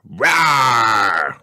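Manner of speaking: put-on little boy's dinosaur roar; cheering roar. A narrator's reading. George cheers his new dinosaur name with a roar. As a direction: roar for joy like a tiny dinosaur. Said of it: "cheered George".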